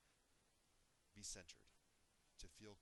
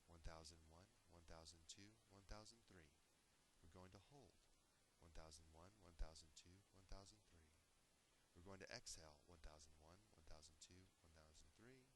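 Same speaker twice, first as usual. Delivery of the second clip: guided breathing count; 2 semitones lower, 4 dB quieter than usual. Shown as a demonstration. Demonstrating box breathing to trainees, hushed guiding count. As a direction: count quietly and evenly through each breath.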